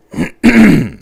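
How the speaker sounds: loud throat clear. Real, not acted. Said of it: "I've got a frog in my throat".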